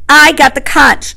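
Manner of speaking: high, loud protest; indignant. A narrator's reading. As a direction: insist on it, affronted. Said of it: "said Piggy indignantly".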